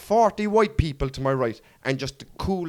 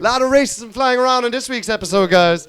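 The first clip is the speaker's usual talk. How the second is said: mock announcer; 8 semitones higher, 7 dB louder than usual. Real, not acted. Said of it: "mock announcer sign-off".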